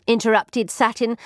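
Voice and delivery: plain reading voice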